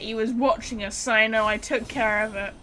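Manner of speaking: normal talking voice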